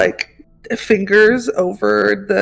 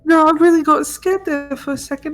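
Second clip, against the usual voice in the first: put-on high, relieved small child; little kid voice; 6 semitones higher than usual. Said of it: "the little baby voice".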